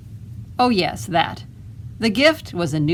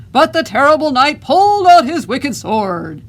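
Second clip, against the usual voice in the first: booming voice; 9 semitones higher, 9 dB louder than usual. Acted. Boy reading aloud to lure the dragon, booming voice. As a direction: boom it out so the hiding dragon can't help but hear. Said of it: "in a booming voice".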